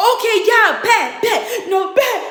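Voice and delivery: high, loud retold outburst; hammed-up hot-headed agreement; mock angry dare. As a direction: fire back like a riled-up boyfriend. Acted boyfriend angrily takes the dare.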